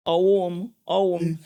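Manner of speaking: imitating Abia and Imo Igbo speech; Abia-Imo accent. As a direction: mimic how Abia and Imo people say "Owom". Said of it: "especially when they speak".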